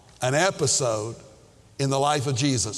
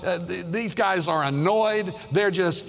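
usual speaking voice

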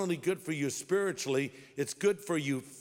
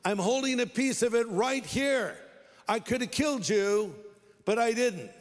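raised, higher voice calling across a distance; yelling out; 6 semitones higher, 3 dB louder than usual. Retold or imitated. David calls to King Saul from a safe distance, yelling out. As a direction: call out as if to someone far off. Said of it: "he yells out".

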